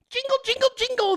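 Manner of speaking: very high, tinny jingle; squeaky high coin-jingle voice; tin cup jingle. Pretend. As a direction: jingle it like coins in a beggar's cup. Mimics begging cup jingle.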